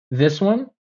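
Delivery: high rising, questioning tone; unsure question. Retold or imitated. Pharmacy clerk holding up a box for customer, unsure question. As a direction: imitate an uncertain clerk checking.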